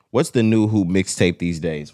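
ordinary delivery

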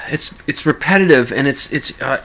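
usual speaking voice

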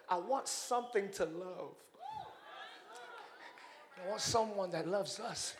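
soft and low, wanting love; mild divine yearning; tender longing. Acted. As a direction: say it quietly from the heart. God voiced longing.

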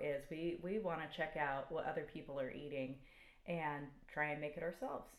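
ordinary delivery

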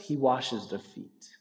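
a quiet, slow, solemn repeat; soft emphasis. Real, not acted. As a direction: let it land quietly, slowly. Closing point, repeated softly.

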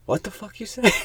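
sharp, high what-the-fuck; angry wife. Retold or imitated. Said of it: "she's like".

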